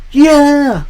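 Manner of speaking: high-pitched crowd reply; crowd answering. Retold or imitated. Crowd answers the wrestler's question, imitated high cheer. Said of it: "he then turns to the crowd".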